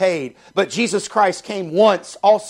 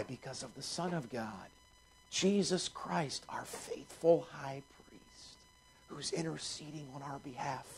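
soft, hushed sermon voice, much quieter; hushed preaching; 3 semitones lower, 14 dB quieter than usual. Acted. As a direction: draw the church in close, almost murmuring. Soft intimate preaching passage.

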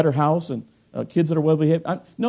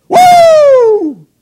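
high, loud whoop of joy; excited woo; 14 dB louder than usual. Real, not acted. Preacher thrilled by Handel's Messiah, loud whoop. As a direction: let out a joyful woo.

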